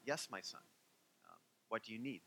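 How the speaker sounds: soft, patient reply; gentle rabbi. Retold or imitated. Hillel answers the rude man, softly and patiently. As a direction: answer him calmly, like a kind teacher. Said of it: "he had such a quiet way".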